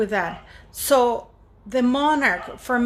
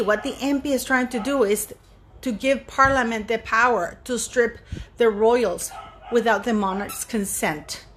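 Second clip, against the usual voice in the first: usual speaking voice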